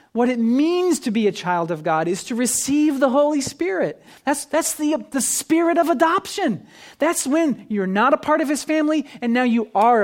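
fervent pulpit preaching; preaching at full force. Acted. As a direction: build it up like a pulpit climax. Pastor preaches on adoption.

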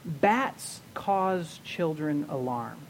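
put-on "Bats cause children alarm" said very slowly, each word spaced out; slow, deliberate reveal of the acrostic; slow mnemonic. Shown as a demonstration. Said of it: "What about this?".

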